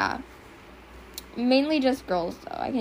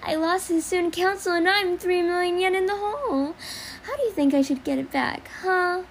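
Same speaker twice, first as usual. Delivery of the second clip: put-on much higher, playful cat-girl voice; Yumiko Jabami cat girl; 8 semitones higher than usual. Shown as a demonstration. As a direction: purr and tease like an anime cat girl. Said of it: "cat girl".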